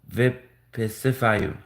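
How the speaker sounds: Выписываю drawn out slowly as vee-pee-see-va-yu; sounding out. Shown as a demonstration. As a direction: stretch every syllable of Выписываю. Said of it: "I was hard to pronounce".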